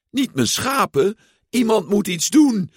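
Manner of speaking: a porcelain doll calling for help in alarm; crying out as Bo Peep. Acted. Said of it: "riep Bo Peep".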